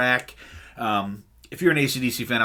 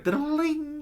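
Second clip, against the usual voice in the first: guitar sting imitation.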